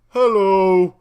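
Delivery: loud, drawn-out booming ogre greeting; big dim ogre. Acted. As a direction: bellow it slowly like a big simple giant. Big ogre character greeting the party.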